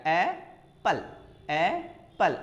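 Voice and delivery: slow, ए and पल said as two clearly separate beats; split into syllables. Shown as a demonstration. Teacher showing students the two syllables of apple, slow and split. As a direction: pull एप्पल apart as ए ... पल. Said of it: "दो syllable हो गया".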